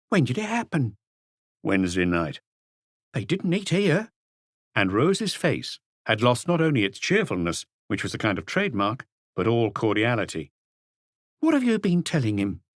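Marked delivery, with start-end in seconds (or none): 0.1-4.1 high, lively voice of a talkative landlady; bubbly, familiar chatter; chatty landlady Rose. A narrator's reading. Rose reminds Maigret of the old case, high and chatty. 11.4-12.6 high, sharp accusing question; suspicious landlady. A narrator's reading. Rose confronts her husband Bob, sharp and suspicious.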